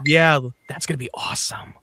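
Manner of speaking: sarcastic whisper. Real, not acted. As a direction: whisper it as a dry joke. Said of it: "sarcastic whisper aside".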